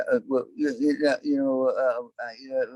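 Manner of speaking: high, stammering mumbled impression. Rock star answers a fan's question, stammering high-pitched impersonation. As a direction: stumble through filler words in a high rock-singer mumble. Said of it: "That's a great impersonation".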